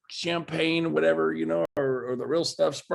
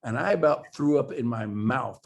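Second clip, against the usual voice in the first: ordinary delivery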